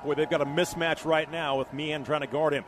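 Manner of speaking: usual speaking voice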